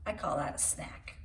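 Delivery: soft playful whisper; whispered aside. Real, not acted. Reader whispering a joke to children, hushed. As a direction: murmur the joke under your breath.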